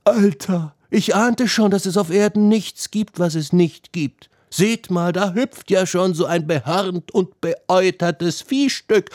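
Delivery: high, acted voice jeering at the goats; high-voiced mocking youngster. A narrator's reading. Visitor mocking the goatherd's filthy goats, in high scorn. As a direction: play a scornful visitor, pitched well up.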